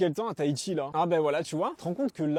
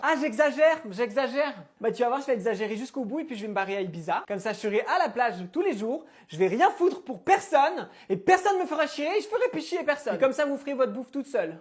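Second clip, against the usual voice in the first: melodramatic angry mother; 3 semitones higher, 5 dB louder than usual. Acted. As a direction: storm off like a martyr mum. Mother's rant continues.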